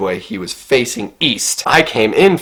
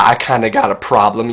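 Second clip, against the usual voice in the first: ordinary delivery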